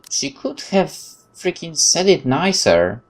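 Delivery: slow, high exasperated complaint; annoyed whine. Real, not acted. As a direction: drag it out, peeved and high. Player annoyed at character.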